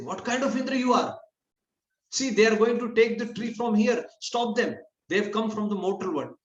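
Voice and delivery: high-pitched retold urging; goading. Mother Sachi instigating Indra to stop them, agitated goading. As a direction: needle him into stepping in, agitated. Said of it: "then she instigates Indra".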